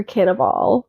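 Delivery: Cannavale ending in "vahl", silent final e; alternative pronunciation. Shown as a demonstration. Guessing again at an actor's surname, Cannavale tried as "can-a-vahl". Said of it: "however his name is pronounced".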